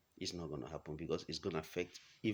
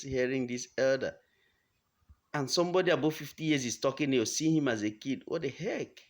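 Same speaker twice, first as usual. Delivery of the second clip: loud, high-pitched exasperated rant; fed-up outburst; 6 semitones higher, 7 dB louder than usual. Real, not acted. Fed up with hearing about the elder, indignant outburst. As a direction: vent loudly, pitch pushed up in real exasperation.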